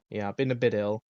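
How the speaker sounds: ordinary delivery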